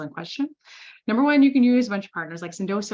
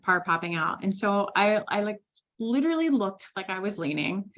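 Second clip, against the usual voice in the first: ordinary talk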